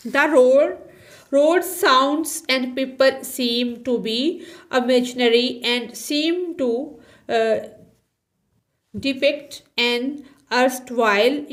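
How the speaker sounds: reading the English line aloud, x0.4 word by word; slow textbook reading. Shown as a demonstration. Teacher reading the textbook line slowly to students. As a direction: read each word slowly for the class.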